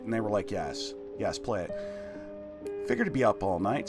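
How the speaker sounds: usual speaking voice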